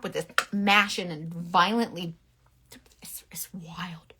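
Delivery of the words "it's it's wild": slow, unvoiced whisper; whispered aside. Real, not acted. Whispering to viewers about brush mashing, slow.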